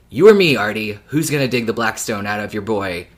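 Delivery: ordinary talk, nothing marked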